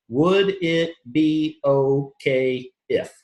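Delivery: Would it be okay if stretched very slowly, rising on if; slow, drawn out. Shown as a demonstration. Coach drilling the exact phrase to the audience, very slow. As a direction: spell out Would it be okay if word by word. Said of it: "I make sure it's those words".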